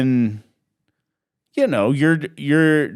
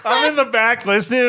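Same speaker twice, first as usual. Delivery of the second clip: laughing; 9 semitones higher than usual. Real, not acted. Cracking up telling the story.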